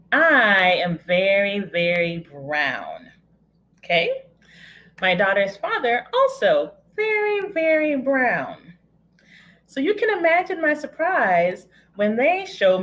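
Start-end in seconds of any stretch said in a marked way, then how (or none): 0.1-3.1 very, very brown stretched slowly with falling tune; drawn-out emphasis. Acted. Telling the audience about her skin, slow emphatic very. 6.1-8.6 "also very, very brown" said high and slow, falling; drawn-out emphasis. Acted. Describing the baby's father, slow emphatic very. Stressing father's skin colour.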